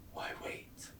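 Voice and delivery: taunting whisper. Acted. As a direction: dare her under your breath. Villain daring her.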